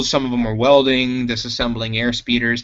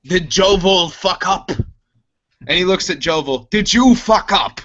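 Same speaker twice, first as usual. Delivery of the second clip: accusing; 6 semitones higher than usual. Acted. Chopper confronts Jovel, raised and accusing. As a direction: demand an answer from Jovel. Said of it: "He goes".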